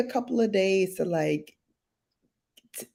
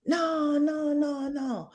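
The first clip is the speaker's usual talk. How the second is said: high, soft run of no's; sing-song mock insistence; aunt's insistence, imitated; 9 semitones higher, 4 dB quieter, slower than usual. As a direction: brush it off as a fussing aunt. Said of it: "She like".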